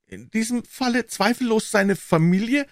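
put-on high, eager young man's voice; Offerton chiming in. A narrator's reading. Offerton interrupting Holmes with a suggestion, high and eager.

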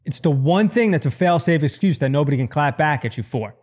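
usual speaking voice

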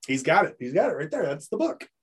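normal talking voice

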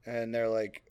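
ordinary talk, nothing marked

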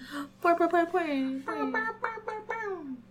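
high, drawn-out gasp of awe; mock gasp. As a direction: gasp like it's a celebrity. Reacting to patron name.

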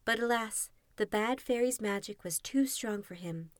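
plain reading voice

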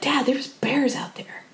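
whispered, slow warning to dad; a slow whisper of worry; hushed warning. Retold or imitated. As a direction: breathe it like the bears might hear. Warning dad about bears.

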